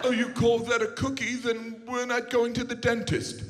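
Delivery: mock posh British voice, slowed at the end; playing a snooty Brit mocking Americans. Comedian mocks British people copying Americans, mock British voice. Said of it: "They're like".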